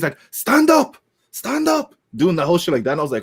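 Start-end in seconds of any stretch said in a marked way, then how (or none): 0.3-1.9 very high mock Nigerian-accent command; Apollo Crews' Nigerian accent. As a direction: imitate a wrestler ordering his rival up in a high Nigerian accent. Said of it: "doing, like, the, the whole accent".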